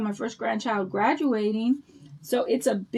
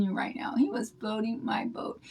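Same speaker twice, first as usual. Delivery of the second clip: whispered, softer, like a secret shared with viewers; giddy hushed aside; delighted whisper; 8 dB quieter than usual. Real, not acted. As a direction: lean in and whisper the confession. Flattered grandma confides to viewers.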